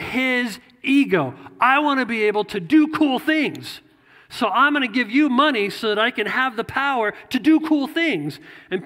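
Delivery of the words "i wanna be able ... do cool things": higher, louder greedy wannabe; eager Simon. Retold or imitated. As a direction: voice Simon begging for power, louder. Voicing Simon wanting power.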